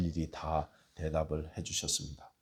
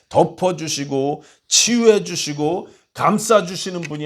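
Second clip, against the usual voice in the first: louder, higher, fervent sermon voice; preaching at full force; 9 semitones higher, 11 dB louder than usual. Acted. Pastor preaching to his congregation, full force. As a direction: preach it out to the hall.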